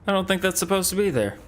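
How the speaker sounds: high, quick bemused reaction, dropping at the end. Real, not acted. Puzzled reaction to a game glitch.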